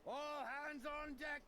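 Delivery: high muffled shout from above; through a wall. Acted. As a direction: call it as if heard through the deck. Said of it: "A muffled voice called up from up above".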